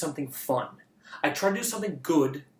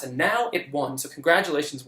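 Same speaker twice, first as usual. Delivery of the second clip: ordinary talk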